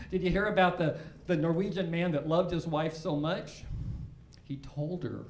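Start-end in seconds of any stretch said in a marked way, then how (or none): 4.4-5.3 breathy unvoiced whisper; whispered punchline. Comic telling the Norwegian joke, whispering the punchline. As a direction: whisper it as a secret.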